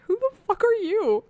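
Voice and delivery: high-pitched mock bafflement; incredulous character reaction. Character reacts to the intruder, high mock incredulity. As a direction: squawk it in comic disbelief. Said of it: "Edward's like".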